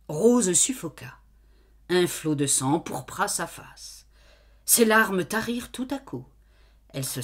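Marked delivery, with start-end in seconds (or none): none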